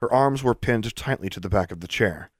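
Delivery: ordinary delivery, nothing marked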